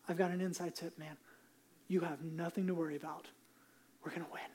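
soft, secretive reassurance dropping to a whisper; telling a worried boy his team will win; confiding hush. Pretend. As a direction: lean in and let him in on a secret. Confiding tip to son.

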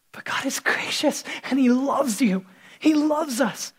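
hushed whisper of grace. Real, not acted. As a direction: whisper it tenderly to the flock. Tender turn in sermon.

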